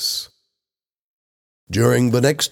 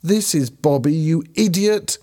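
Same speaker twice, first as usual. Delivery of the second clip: raised, high-pitched scolding; angry shouting; 9 semitones higher than usual. A narrator's reading. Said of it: "Helen shouted".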